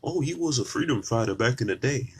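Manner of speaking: high, airy voice of a gushing fan; sing-song mock reverence; mock admirer. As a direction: parody the people who idolize him. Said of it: "People want to revere people".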